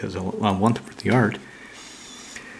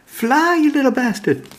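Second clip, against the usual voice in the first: much higher, louder cheering shout; yelling owner voice; 5 dB louder than usual. Pretend. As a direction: urge the creature on at the top of the voice. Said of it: "And she's like".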